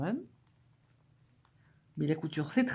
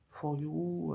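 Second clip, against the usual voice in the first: English accent; 4 dB quieter than usual. Real, not acted. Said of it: "Vous avez vu comme je parle bien l'anglais?".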